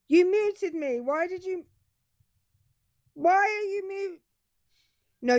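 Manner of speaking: high, mocking put-on caller voice; Dirk. Host mimics a caller who keeps interrupting, mocking high voice. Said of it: "my impression of me being like Dirk".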